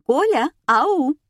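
high, loud, drawn-out hail; calling out. A narrator's reading. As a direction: call out to rouse an absent caller. Radio host hailing a caller. (звонкий протяжный оклик)

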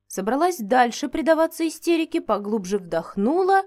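ordinary delivery

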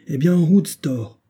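high, loud call to set off; brisk order. A narrator's reading. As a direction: give the order to go, voice lifted. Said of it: "répondit Banks". (ordre lancé d'une voix haute)

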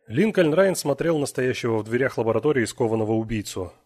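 usual speaking voice

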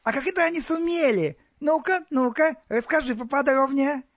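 very high, eager glee; gleeful. A narrator's reading. Pharaoh demands details of the poisoning, high and gleeful. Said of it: "фараон прямо-таки развеселился".

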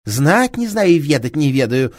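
woman snapping back at the inspector; curt retort. Acted. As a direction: cut him off flatly. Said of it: "отрезала та".

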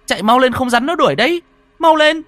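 high, loud shouting, rising in urgency; excited cry. Acted. Boy urging his lost friend to flee, excited urgent shouting. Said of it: "Tôi vui mừng kêu lên".

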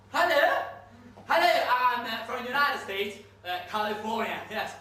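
loud, high, halting English of an oilman; American oilman. Acted. Unocal man calling Afghanistan, loud eager American. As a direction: shout down a bad line, eager salesman.

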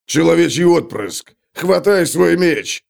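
slow, gruff dwarf yelling; dwarf's yell. A narrator's reading. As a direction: bellow it like a dwarf warrior. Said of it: "услышал он вопль Готрека".

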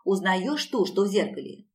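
ordinary delivery

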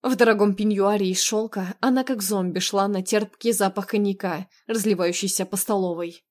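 plain reading voice

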